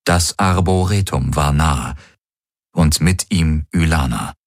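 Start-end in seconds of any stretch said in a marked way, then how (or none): none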